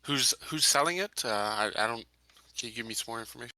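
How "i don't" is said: normal talking voice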